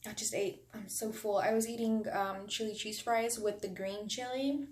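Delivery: normal talking voice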